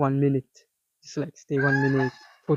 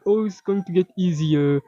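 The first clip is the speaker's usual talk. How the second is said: naive optimist, pitched a bit higher; higher, mocking feel-good voice; mock optimism; 4 semitones higher than usual. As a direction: mimic hopeful wishful thinking. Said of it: "feel-good mentality".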